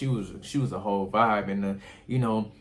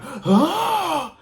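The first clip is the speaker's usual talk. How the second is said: high, loud theatrical gasp; mock gasp; 7 dB louder than usual. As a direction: imitate a sudden dramatic gasp. Imitates her gasp down the hallway.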